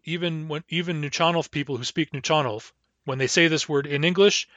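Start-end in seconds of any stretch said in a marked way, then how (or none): none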